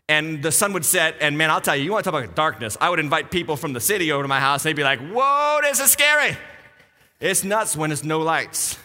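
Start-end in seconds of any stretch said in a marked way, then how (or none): 5.0-6.4 high, exclaimed mock fright; mock scared guest. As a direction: imitate a city visitor spooked by darkness. City guests scared of darkness.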